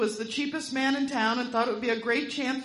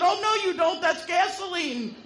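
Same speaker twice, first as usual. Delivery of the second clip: alarmed protest; 9 semitones higher, 4 dB louder than usual. Acted. Smith catching the trick.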